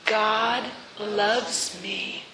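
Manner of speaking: each word drawn out, hushed; slow and soft. Shown as a demonstration. Preacher leading the church in a slow, soft affirmation. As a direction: savor it slowly and gently. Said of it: "Let's say it again".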